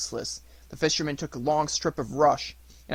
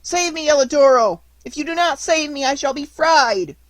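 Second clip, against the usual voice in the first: high, loud desperate plea; trapped puppet crying out to the dog; entreating; 12 semitones higher, 9 dB louder than usual. Acted. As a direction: beg for rescue. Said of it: "saying entreatingly".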